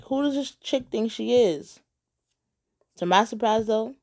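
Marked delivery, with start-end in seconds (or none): 0.0-1.8 higher-pitched, affronted disbelief; who-does-she-think-she-is attitude; indignant. Acted. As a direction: bristle with offence. Indignant inner reaction to the rival.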